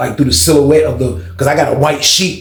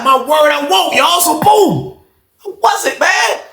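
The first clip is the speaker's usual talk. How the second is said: excited; 12 semitones higher, slower than usual. Real, not acted. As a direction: shout it out, worked up. Excited boast to the audience.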